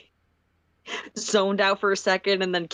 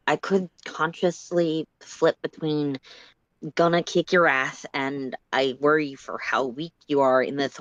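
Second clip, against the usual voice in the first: slowly; 3 semitones lower, slower than usual. Acted. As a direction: weigh every word slowly. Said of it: "Solix says slowly".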